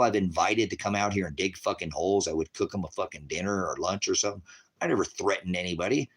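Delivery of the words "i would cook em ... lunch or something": ordinary talk, nothing marked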